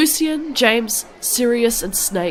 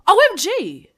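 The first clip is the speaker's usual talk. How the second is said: high, shrieking gasp of shock; shocked squeal; 9 semitones higher than usual. Acted. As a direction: squeal it out in disbelief. Shocked narrator-heroine exclaims.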